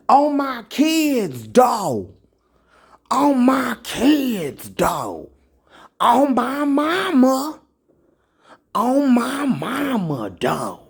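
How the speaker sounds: mock swearing in a high voice; mocking street oath. Preacher mocking how his people swear, in a high voice. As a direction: ham up a high pleading oath. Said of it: "Who talk like that?".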